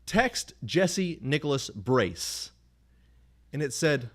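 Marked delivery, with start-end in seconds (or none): 0.1-2.5 very slow, higher, every word spaced out; very slow dictation. Retold or imitated. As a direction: dictate like the phone is hard of hearing. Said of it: "And I said".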